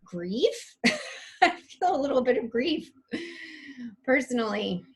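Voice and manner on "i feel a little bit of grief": a light laugh carrying into the words. Real, not acted. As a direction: chuckle while admitting it, fast. Laughing admission of own grief.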